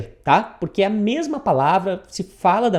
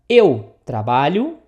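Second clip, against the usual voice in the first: loud marker word, then slow rising phrase; word-for-word gloss; 4 semitones lower, 5 dB louder, slower than usual. Shown as a demonstration. Teacher glossing what "do" means, loud and deliberate. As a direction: announce the first word, then stretch out the question. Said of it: "o que que ele quer dizer aqui".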